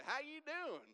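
loud, high-pitched Southern greeting; Southern accent. Retold or imitated. Trooper greeting the driver, exaggerated Southern accent. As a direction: put on a big exaggerated Southern drawl. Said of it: "I did not speak Southern back then".

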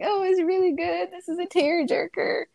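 high, gushing mock rave; gushing friend. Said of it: "she's like".

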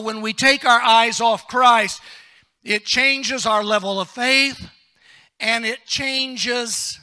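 loud, emphatic sermon delivery; full-force preaching. Real, not acted. Preacher warning the church about losing focus, full-force preaching. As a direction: drive the point home from the pulpit.

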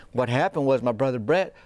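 normal talking voice

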